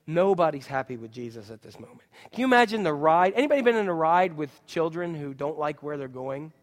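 ordinary delivery

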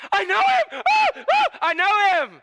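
squealing high, loud with glee; giddy childlike excitement; excited Buddy the Elf. Retold or imitated. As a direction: gush like a thrilled elf. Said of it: "Buddy the Elf knows him and is excited about it".

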